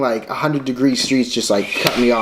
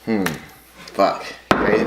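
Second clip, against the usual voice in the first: ordinary delivery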